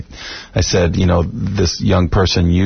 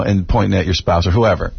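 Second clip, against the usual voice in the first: normal talking voice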